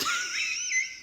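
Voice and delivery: high shrieking laugh at her own joke; burst of laughter. Real, not acted. Talking about leprechauns, she bursts into a high laugh.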